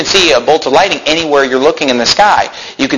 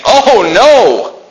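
high, drawn-out cry of dismay; raised, hammed-up lament; mock crying out; 6 semitones higher, 3 dB louder, much slower than usual. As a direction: act out people wailing in dread. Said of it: "crying out, saying".

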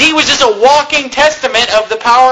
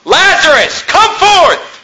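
raised, high-pitched command; loud cry; 7 semitones higher, slower than usual. A narrator's reading. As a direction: call it out like summoning someone from a tomb. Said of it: "he cried with a loud voice".

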